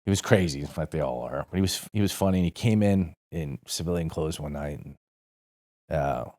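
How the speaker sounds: normal talking voice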